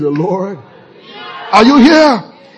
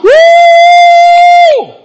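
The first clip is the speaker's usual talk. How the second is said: very high, loud woo; joyful whoop; 8 dB louder than usual. Acted. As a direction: whoop with joy.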